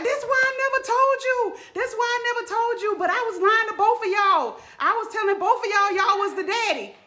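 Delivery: high, loud, desperate confession; the mother crying out the truth after she's hit; mother yelling the secret to both dads, high and loud; mom's anguished outburst. Retold or imitated. Mom confesses after being hit.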